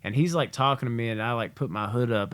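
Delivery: normal talking voice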